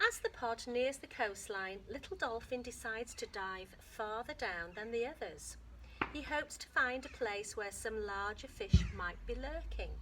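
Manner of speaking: lively read-aloud to a class, lifting the story lines; story reading to children. Acted. Teacher reading a dolphin book aloud to her class, lively storytelling. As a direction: read it to the kids like an adventure.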